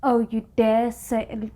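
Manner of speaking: usual speaking voice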